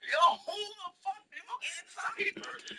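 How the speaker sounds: high-pitched seller impression; baffled Chinese vendor. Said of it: "when I go".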